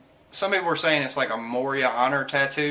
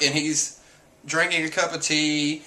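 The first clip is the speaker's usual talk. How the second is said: normal talking voice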